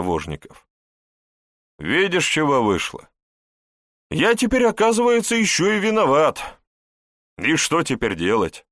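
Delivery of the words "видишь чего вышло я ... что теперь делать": high, loud bewildered complaint; exasperated. Acted. Prince complains to his advisor, exasperated. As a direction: throw up your hands.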